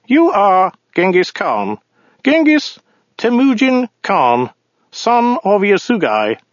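high, slow, formal alien voice; weaselly voice. Acted. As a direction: read out the name like a petty official. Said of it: "weaselly voice".

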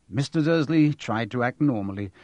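plain reading voice

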